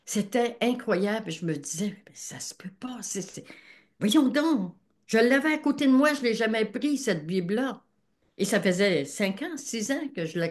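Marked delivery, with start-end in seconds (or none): 2.1-3.5 breathless whispered disbelief; astonished whisper. Retold or imitated. Woman reading the Bible alone at night, whispering in disbelief. 4.0-7.8 astonished exclaim. Retold or imitated. Exclaiming her own astonishment.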